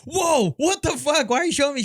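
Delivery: high, loud startled outburst; shocked guy. Retold or imitated. A man shown a brutal video by a woman, shocked high outburst. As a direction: recoil from the video in disbelief. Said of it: "and then he's like".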